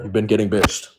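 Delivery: usual speaking voice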